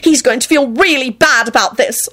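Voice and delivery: high, upset scolding, little added volume; shouting. A narrator's reading. Woman shouting at the two others, high upset scolding. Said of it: "Elizabeth shouted at Quentin and Lucretia".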